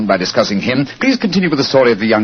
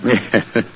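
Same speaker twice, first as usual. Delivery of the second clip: short soft chuckle; a quick amused laugh under the breath; 2 semitones lower, 3 dB quieter than usual. Acted. Holmes amused by Watson's hint.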